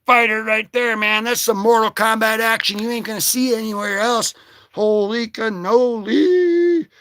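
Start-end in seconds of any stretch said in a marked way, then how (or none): 4.7-6.9 excited exclamation. Real, not acted.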